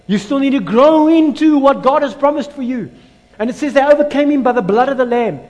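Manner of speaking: loud, high, impassioned preacher; thundering pulpit proclamation; full-force preaching. Real, not acted. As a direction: belt it out to the congregation, stretching key phrases.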